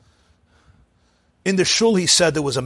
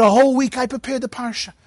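put-on high, aggrieved protest from an old Torah reader; indignant old man; 9 semitones higher than usual. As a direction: complain that nobody told you, pitched up. Moshe repeats his complaint.

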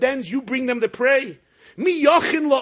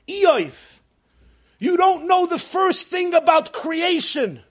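rebuke; 7 semitones higher, 4 dB louder than usual. Retold or imitated. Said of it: "All he's telling Eov".

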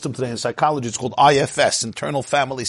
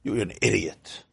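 low, soft, slow inner put-down; a hint of a cold inner critic; inner attacker; 6 semitones lower, 7 dB quieter than usual. Rabbi voicing the inner voice that attacks, low and soft. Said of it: "there's an attack".

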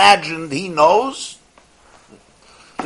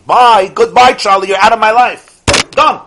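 loud, curt brush-off; mock dismissal; 6 semitones higher, 10 dB louder than usual. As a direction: wave them out of your life for good.

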